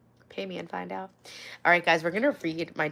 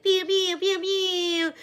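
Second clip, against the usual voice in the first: soundboard pews; 10 semitones higher, much slower than usual. Retold or imitated. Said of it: "like a radio host".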